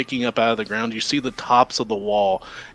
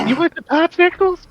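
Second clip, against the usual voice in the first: put-on high creaky old man voice; 6 dB louder than usual. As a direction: squeak it out like a creepy old man. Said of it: "old guy from Family Guy".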